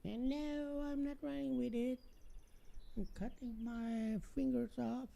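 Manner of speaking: put-on high-pitched kid voice; child voice. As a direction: play a defiant little kid, high. Child and mom scissor skit.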